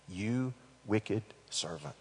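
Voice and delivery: "You wicked servant" dropped low and soft; quiet, menacing. Shown as a demonstration. The master repeats his rebuke, quiet and menacing.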